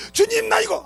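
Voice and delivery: pleading believer. Retold or imitated. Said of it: "육신으로 살다가".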